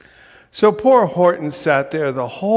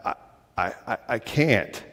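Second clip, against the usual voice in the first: softer, halting stammer; 6 semitones lower, 5 dB quieter than usual. A narrator's reading. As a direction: trip over the first word. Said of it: "said the elephant".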